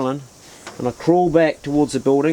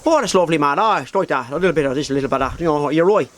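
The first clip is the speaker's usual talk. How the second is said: lilting Dublin pub chat; rough-and-ready impression of a Dublin accent; faster than usual. Shown as a demonstration. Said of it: "So that's the kind of Dublin".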